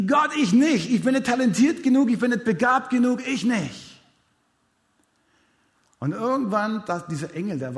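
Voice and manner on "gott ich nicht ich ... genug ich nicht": much higher and louder, pleading refusal; desperate protest. Acted. Gideon refusing God's call, loud and pleading. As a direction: cry out that you're not good enough.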